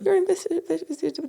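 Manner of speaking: talking through braces; lisping round new braces. Real, not acted. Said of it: "I've got my braces in, so it's hard to talk".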